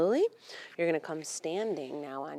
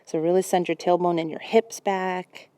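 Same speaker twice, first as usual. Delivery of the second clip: usual speaking voice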